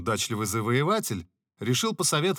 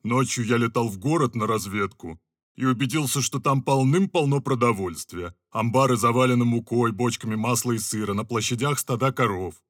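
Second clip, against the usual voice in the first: louder scout's report; lightly voiced owl; 3 semitones higher, 6 dB louder than usual. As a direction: report calmly. (лёгкий голос филина-разведчика)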